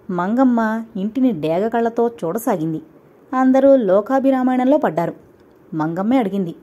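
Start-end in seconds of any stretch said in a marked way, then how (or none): none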